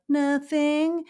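lightly voiced child; imitated kid. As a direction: play a kid clamming up at the doctor's. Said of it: "the kids are sitting there".

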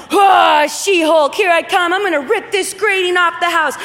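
hammed-up superhero charge, all effort; She-Hulk super mom. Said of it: "the tales of the super moms".

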